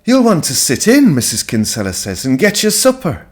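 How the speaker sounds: lightly voiced country woman inviting guests in; Missus Kinsella voice. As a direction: offer supper as the kind hostess.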